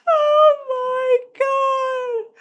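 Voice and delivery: high, loud, drawn-out cry of disbelief; stunned outcry. Real, not acted. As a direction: stretch it out in a high yell. Reacts to the twist.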